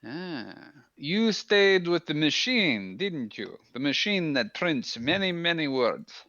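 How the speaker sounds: a lightly voiced soldier, formal and friendly; Minx in character. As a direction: speak as Minx, stiff and formal.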